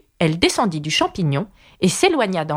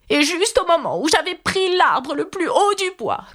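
complaining pigeon; 8 semitones higher than usual. Acted.

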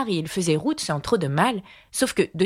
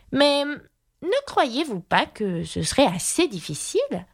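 put-on slow, higher girl's question; pensive doubt; 8 semitones higher, slower than usual. A narrator's reading. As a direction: raise the doubt gently and slowly. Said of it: "d'un ton pensif". (fillette songeuse, lente et hésitante)

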